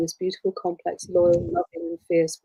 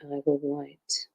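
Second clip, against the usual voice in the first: ordinary talk, nothing marked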